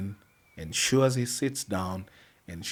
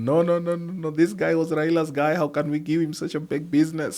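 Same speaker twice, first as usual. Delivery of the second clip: raised-pitch imitation of indignant objectors; mock objection; 7 semitones higher than usual. Officials object to hiring Raila's guy, in mock protest. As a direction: run the protests together, pitched up.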